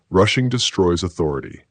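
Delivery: ordinary talk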